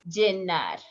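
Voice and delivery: normal talking voice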